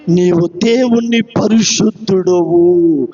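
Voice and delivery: low, drawn-out imitation of a crying spirit; slow, low, solemn retelling of a cry; demon's cry retold. Preacher voices the demon's cry to the congregation, slow and low. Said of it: "ఏమని కేకలేస్తుంది".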